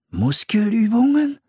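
high, startled question. A narrator's reading. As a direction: think it aloud in high alarm. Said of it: "dachte Philipp erschrocken". (erschrocken, hoch fragend)

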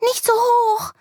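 put-on very high, scared child's cry; girl on a swing pushed too hard, crying out high; frightened cry. A narrator's reading. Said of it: "schreit Amelie".

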